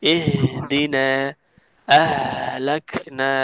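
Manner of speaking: ইহদিনা and আহলাকনা slow, the হ breathed out open; breathy ha demo. Shown as a demonstration. Teacher showing open vocal cords for the letter ha. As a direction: let air flow through the হ in আহলাকনা. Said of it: "হা বলার সময় vocal কর্ডটা পুরোপুরি খোলা থাকে".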